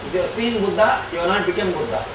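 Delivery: normal talking voice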